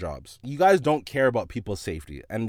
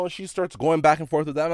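ordinary delivery

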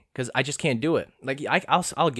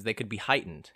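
ordinary delivery

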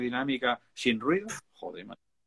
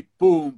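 a loud, punchy pum like a kick drum; kick drum imitation; 4 semitones higher, 12 dB louder than usual. Explaining the drums of Jump to his friend, loud punchy kick-drum pum. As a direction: hit it like a bass drum beat.

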